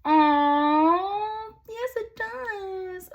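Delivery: very high, slow delighted squeal; high slow gushing yes; thrilled squeal. Real, not acted. Thrilled at lucky card.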